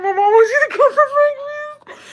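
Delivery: high, loud, laughing half-shout; mortified squeal. Retold or imitated. Nephew tells uncle breakfast is ready, mortified squealing shout. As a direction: blurt it out mortified, almost crying with laughter.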